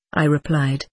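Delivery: plain reading voice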